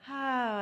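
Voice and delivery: loud sighing "ah" on the out-breath; demonstrative audible sigh of release; voiced exhale. Shown as a demonstration. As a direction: breathe out with sound, high and loud. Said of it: "make sound on the exhalation".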